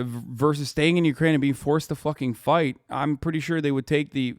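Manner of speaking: ordinary talk, nothing marked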